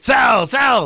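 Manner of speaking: high, loud shouts to sell; mock trader shout. Host mimicking a wrestler selling like a trader, loud. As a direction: yell it like a floor trader.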